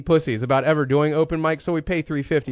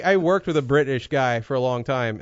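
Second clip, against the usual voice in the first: ordinary delivery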